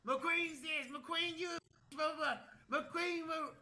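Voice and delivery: high-pitched blah-blah imitation; mocking rant. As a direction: mimic her ranting in a high voice. Mocks the girl's rant.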